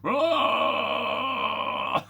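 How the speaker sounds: long low roar; imitated yell. Officer demonstrating yelling to his crewmate, long low roar. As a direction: let out a drawn-out mock yell, pitched well down. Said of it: "He just yells".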